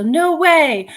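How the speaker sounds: no way, genuinely amazed; surprised. Real, not acted. On hearing of the Polaroid find, amazed.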